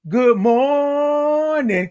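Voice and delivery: "morning" held long and high on "mor"; drawn-out greeting. Real, not acted. Host saying hey to Cat, drawn-out singsong "morning". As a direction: sing out "morning", stretching the first syllable. Signature stretched greeting to a viewer.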